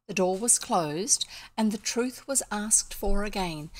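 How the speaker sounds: ordinary delivery, nothing marked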